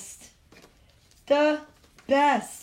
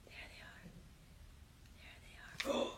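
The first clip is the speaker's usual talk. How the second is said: whisper; 23 dB quieter than usual. Real, not acted.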